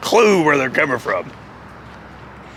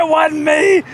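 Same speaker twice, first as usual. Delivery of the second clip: Jeff Jarrett; 11 semitones higher, slower than usual. Retold or imitated. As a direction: imitate someone desperately protesting innocence. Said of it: "he's running up there".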